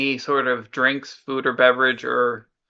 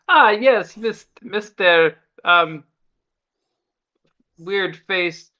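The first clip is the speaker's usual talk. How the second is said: a lightly put-on, bad Italian gnome voice, higher; terrible gnome accent; 4 semitones higher than usual. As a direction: try a gnome's Italian accent and keep missing it. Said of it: "That is a terrible accent".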